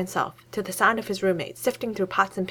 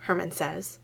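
usual speaking voice

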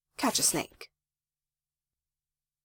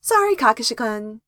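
higher, louder cheery apology; gleefully unregretful, pitched up; cheerful; 5 semitones higher, 4 dB louder than usual. A narrator's reading. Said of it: "knew the cheer in his voice".

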